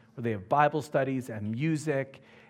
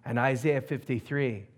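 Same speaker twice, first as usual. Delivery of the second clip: usual speaking voice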